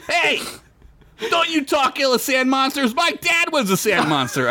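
high, indignant call from the back; a half cat man defending his dad; offended cat man. Acted. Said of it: "said from the back".

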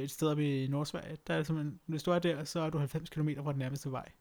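ordinary talk, nothing marked

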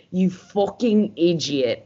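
slow, drawn-out put-on Irish accent; exaggerated Irish-style accent, relished. Shown as a demonstration. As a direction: savor the insult in a mock Irish accent. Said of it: "favorite fucking thing to say in an accent".

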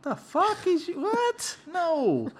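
high-pitched mock disbelief; incredulous disbelief. Mock reaction to a bad gift.

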